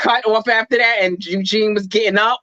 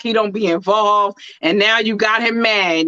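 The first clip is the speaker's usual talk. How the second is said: usual speaking voice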